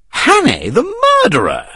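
Hannay cried high in alarm; crying out. A narrator's reading. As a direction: shout Hannay in shock. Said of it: "he cried".

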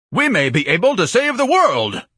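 loud, high, grandiose proclamation; orator's bellow. Acted. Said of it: "Ignatius bellowed in an orator's voice".

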